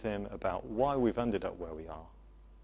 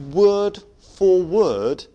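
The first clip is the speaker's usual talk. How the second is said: "word for word" slow, loud and drawn out; stress on word for word; 8 semitones higher, 12 dB louder, much slower than usual. Real, not acted. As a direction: hammer each word of "word for word". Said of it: "and I mean".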